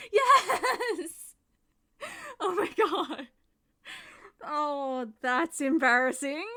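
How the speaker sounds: loud relieved laughter; laughing. Real, not acted. Mic finally works, laughing.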